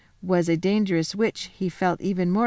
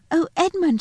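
high, bright call of delight; excited cry; 11 semitones higher, 4 dB louder than usual. A narrator's reading. Lucy overjoyed to find Edmund in the wood, high excited cry. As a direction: burst out with joy at seeing him. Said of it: "she cried".

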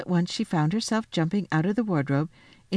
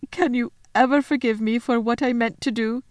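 higher, remorseful faun voice; contrite plea; 6 semitones higher than usual. Acted. Faun asking Lucy to forgive his plot, contrite. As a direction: ask forgiveness with a touch of distress.

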